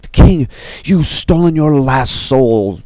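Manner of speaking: slow, high, drawn-out declaration; dramatic defiance. Acted. Chacha confronts the villain over the king, dramatic defiance. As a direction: stretch it out high like a hero's challenge.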